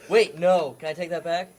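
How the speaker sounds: usual speaking voice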